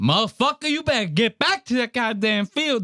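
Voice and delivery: mock overseer order. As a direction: bark it like a hammed-up overseer. Overseer voiced in the retold scene.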